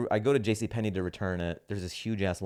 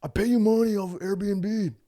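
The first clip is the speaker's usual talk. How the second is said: mock outburst; 13 semitones higher, 5 dB louder than usual. Comic protesting to an Airbnb host, mock outburst. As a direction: put on a much higher voice to complain.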